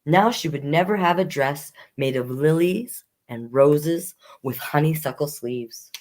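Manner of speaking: plain reading voice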